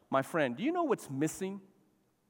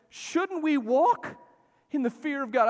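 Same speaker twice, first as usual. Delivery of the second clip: preaching; 11 semitones higher, 5 dB louder than usual. Real, not acted. Preaching the key question.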